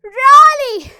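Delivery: loud, high, indignant challenge; angry Really. Shown as a demonstration. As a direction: demand it, hands on hips. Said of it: "fifth dialogue बोलेंगे". (गुस्से में "Really?")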